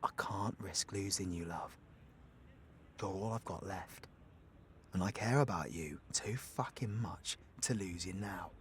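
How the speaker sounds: low, soft confession; tender sincerity. Acted. Telling love they're all I've got left, tender. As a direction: speak softly and low, earnest and intimate. Confesses fear of losing loved one.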